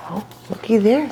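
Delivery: ordinary delivery, nothing marked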